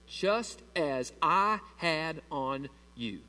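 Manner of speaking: "just as I had on you" very slow, word by word; key-phrase emphasis. Shown as a demonstration. Preacher showing church the key phrase, "just as I had on you" slowly spaced. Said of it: "Here's the key phrase".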